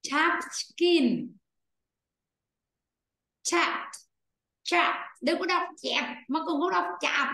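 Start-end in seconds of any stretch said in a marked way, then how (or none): 0.0-5.0 Chapped said with a clear open a and final p; slow careful Chapped, chap-t; teacher's model pronunciation. Shown as a demonstration. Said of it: "mà đọc là é be này".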